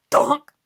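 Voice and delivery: sudden loud "donk" of impact; imitated thud. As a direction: punch out a cartoon collision noise. Imitates thing hitting window.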